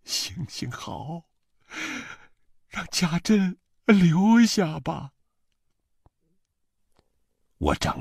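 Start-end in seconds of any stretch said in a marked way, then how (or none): 0.0-5.1 slow, high, drawn-out plea; tearful pleading. A narrator's reading. Mother begs for her daughter-in-law to stay, tearfully pleading. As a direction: beg through tears, rising in pitch. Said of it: "眼泪汪汪地对她说".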